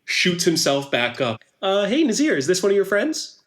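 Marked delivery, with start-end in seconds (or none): 1.6-3.4 high, easygoing character voice; friendly character. Acted. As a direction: ask it lightly, pitched up. GM voices Klaus chatting upside down.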